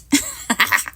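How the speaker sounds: a short amused chuckle; little laugh. Real, not acted. Crafter amused by the unicorn's toot, short chuckle. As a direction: chuckle at the silly detail.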